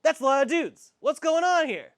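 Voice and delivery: a character caught off guard, voice jumping up; surprised adventurer blurting out at the sight of bugbears; startled character. Acted. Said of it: "And then he's like".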